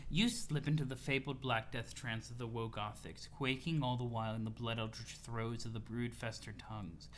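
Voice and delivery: low, soft ominous storyteller voice; dramatic narration read. Acted. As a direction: read it like a grim epic. Reads Homestuck narration dramatically.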